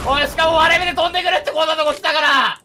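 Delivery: high, loud complaint; exasperated shouting. Real, not acted. Player gripes about being dropped here, exasperated shout. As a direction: shout the grievance at full pitch.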